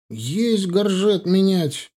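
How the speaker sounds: high, drawn-out military acknowledgement; elated. A narrator's reading. Young officer accepting the order to change gorget, elated. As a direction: sing out the order proudly, slowly. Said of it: "крикнул восторженно молодой офицер".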